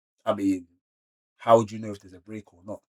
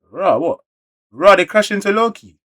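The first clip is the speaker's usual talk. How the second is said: excited reaction; 9 semitones higher, 9 dB louder than usual. Real, not acted. Reacting to crash scene.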